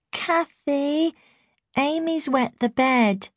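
put-on high, slow little-girl voice; small child's voice. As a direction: speak like a tiny regressed child. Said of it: "woken by a small voice".

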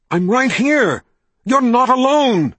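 desperate shout. A narrator's reading. Richard begging Kaelin to hear him, desperate shout. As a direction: shout it to reach her.

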